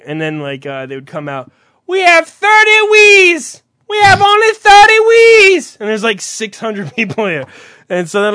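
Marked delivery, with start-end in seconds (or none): 1.9-5.8 high, loud, drawn-out holler; shouted announcement. Retold or imitated. Store staff announcing stock to the line, shouted. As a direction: call it out slowly over a big crowd.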